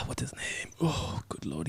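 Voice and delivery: breathy whisper under the breath; whisper to self. Real, not acted. Reviewer whispering to himself while forgetting a name. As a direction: murmur it to yourself while searching memory.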